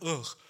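loud mock retch of disgust; disgusted "ugh". Disgusted at his own crude joke, mock-grossed-out groan.

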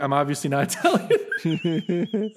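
slow quote breaking into laughter; laughing mock admission. Voicing the actor owning his bad accent, laughing. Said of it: "because he's like".

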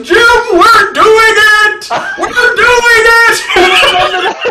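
high, loud, slow triumphant yelling; excited shout. Retold or imitated. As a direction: shout it like you can't believe it's working. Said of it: "Cody goes".

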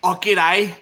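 exaggerated Aussie greeting; a broad g'day; Australian accent. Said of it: "This is how we talk, is it".

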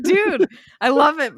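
high, eager; excited quote. Retold or imitated. Quoting a character gushing, high and eager. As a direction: gush it. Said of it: "she's like".

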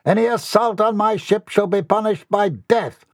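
high, grand captain's decree; imperious captain. Acted. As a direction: proclaim it as master of the ship. Boy playing a ship's captain.

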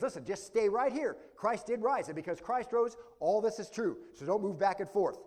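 rally the church to stand firm; urgent preacher's plea. Acted. Voicing Paul's urgent plea.

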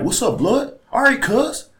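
put-on high, loud Compton accent. Imitating his homies from LA, loud high Compton accent.

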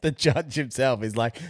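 ordinary talk

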